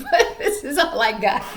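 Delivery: laughing at yourself; self-mocking laughter in the voice. Real, not acted. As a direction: break into a laugh while apologizing. Laughing at her own bad accent.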